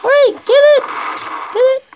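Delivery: high, urgent egging on; urgent cheering on. Real, not acted. Player urging teammates to get the flag, excited. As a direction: urge them on in short high bursts.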